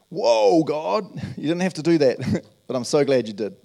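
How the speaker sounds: mock amazed gratitude; amazed thanks. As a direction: gasp in delight at God.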